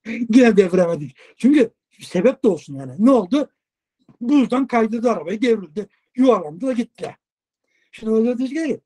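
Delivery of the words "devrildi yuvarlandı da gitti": ordinary talk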